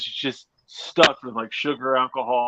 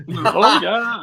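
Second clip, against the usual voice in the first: high mock Swedish, more like German; mock Swedish accent; 8 semitones higher, 6 dB louder than usual. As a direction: laughingly copy a bad Swedish accent. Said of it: "that sounds more German to me".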